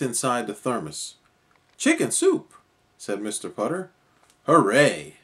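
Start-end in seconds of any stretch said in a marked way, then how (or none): 1.8-2.5 delighted. A narrator's reading. Delighted at the thermos. 4.5-5.2 cheer. A narrator's reading. Cheering over soup.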